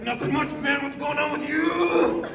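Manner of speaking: loud, high, slow laughing bluff; mock casual bravado. Prankster answering the stranger, loud laughing fake cool. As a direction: play it cool while cracking up. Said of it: "I was like".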